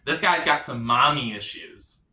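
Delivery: ordinary talk, nothing marked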